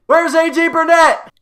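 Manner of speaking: sudden high, loud call out; mock yell. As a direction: bellow the question like searching a crowd. Running gag, yelling for missing player.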